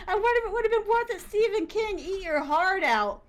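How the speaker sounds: high, fast, laughing repeat. Real, not acted. Asking herself again if the burned book was worth it, laughing high and fast. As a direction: laugh it out, squeaky and quick. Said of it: "repeated with a laugh".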